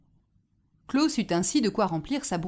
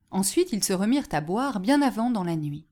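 usual speaking voice

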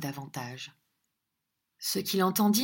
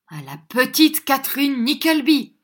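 loud, high answering cheer; chorus the toast back boisterously; hearty shouted repeat of a toast; echoed toast; 7 semitones higher, 9 dB louder than usual. A narrator's reading. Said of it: "Répétèrent les trois autres".

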